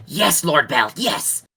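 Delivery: eager imp yelping yes, last yes jumping high; nervous imp. Acted. Sweating imp flies up answering Lord Bell, frantic. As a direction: scramble to please your master.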